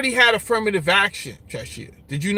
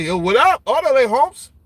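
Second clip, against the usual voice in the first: put-on loud, higher Chicano-accented reply; 4 semitones higher, 3 dB louder than usual. Streamer answering the viewer's greeting, Chicano accent. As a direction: answer back like a West Coast homie. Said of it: "I heard the accent and everything".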